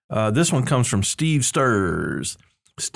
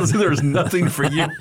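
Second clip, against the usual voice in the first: breaking into laughter mid-sentence; amused, giggling over the line; laughing; 6 semitones higher than usual. Real, not acted. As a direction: laugh through the words at your own slip. Laughing at misread review line.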